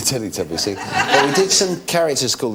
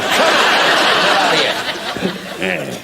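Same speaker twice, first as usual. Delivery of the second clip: mock taunt; 11 semitones higher, 4 dB louder, much slower than usual. Taunting the TV audience.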